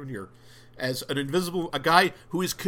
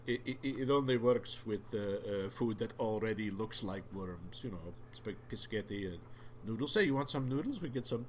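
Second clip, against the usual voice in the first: muttered reply; 6 semitones lower, 8 dB quieter than usual. Retold or imitated. Voicing Stu flatly.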